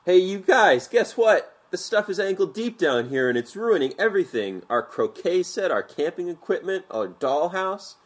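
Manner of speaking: howling from the basement. A narrator's reading. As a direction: yell it up the stairs in dismay. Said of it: "Amy howled from the basement".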